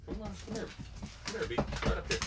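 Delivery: high coaxing call; calling the dog. Real, not acted. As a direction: coax him up gently.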